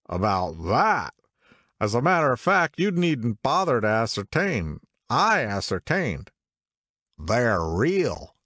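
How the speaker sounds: put-on a drunk man bursting with his discovery; tipsy owlish man insisting the books are real; excited drunk man. A narrator's reading. Drunk man's voice in library.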